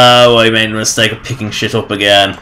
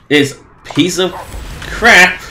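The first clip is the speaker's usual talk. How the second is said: rising to a high angry snap on crap; disgusted outburst; 7 semitones higher, slower than usual. Real, not acted. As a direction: spit out the last word in disgust. Player cursing the useless gun.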